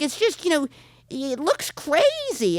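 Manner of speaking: drawn-out disapproving mom; mom's voice. Retold or imitated. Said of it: "And she's like".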